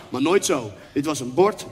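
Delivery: ordinary delivery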